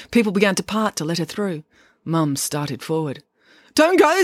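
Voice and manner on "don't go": high, loud desperate plea; urgent plea. Acted. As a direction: call out urgently to stop her. Dad pleads with Mum.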